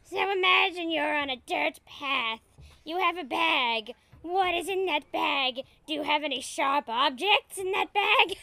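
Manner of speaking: high, loud mock-sinister meditation leader; hammed-up evil storyteller. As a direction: terrorize the children with a creepy guided meditation. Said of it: "Evil jazz voices in their dreams".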